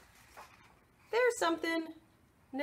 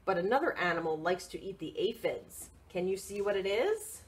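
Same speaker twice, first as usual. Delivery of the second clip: lively picture-book read; reading to children; 6 semitones lower than usual. Acted. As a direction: read it out brightly for little ones.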